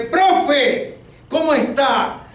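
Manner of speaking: high-pitched, hearty hail; over-eager retold hello; eager greeting. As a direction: greet him in a high, sudden burst.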